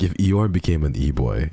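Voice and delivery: ordinary talk, nothing marked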